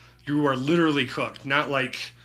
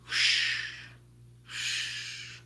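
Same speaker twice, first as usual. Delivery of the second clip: whoosh; 9 dB quieter than usual. Retold or imitated. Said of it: "so it was like".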